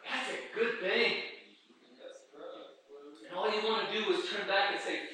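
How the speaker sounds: ordinary delivery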